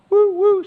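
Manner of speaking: high, loud train whistle hoot; train whistle "woo, woo". Acted.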